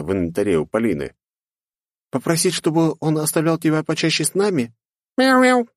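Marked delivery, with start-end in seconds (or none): 2.1-4.7 put-on high, teasing girl voice. Girl asks kitten. 5.2-5.7 very high, loud kitten meows; happy meow. Acted. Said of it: "довольно закивала маленькая чёрная головка".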